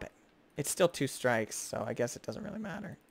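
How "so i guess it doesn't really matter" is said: ordinary talk, nothing marked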